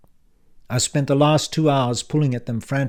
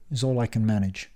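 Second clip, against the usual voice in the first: ordinary delivery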